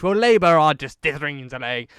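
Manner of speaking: hammed-up rally jab at Labour; impassioned Boris. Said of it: "gives quite an impassioned speech".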